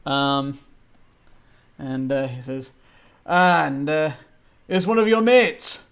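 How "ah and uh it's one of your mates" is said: put-on hearty old gentleman.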